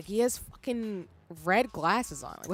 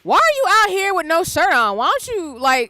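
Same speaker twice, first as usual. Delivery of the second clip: much higher and louder, outraged disbelief; incredulous shout; 7 semitones higher, 10 dB louder than usual. Real, not acted. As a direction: yell at him in shocked disbelief. Mocking the shirtless character.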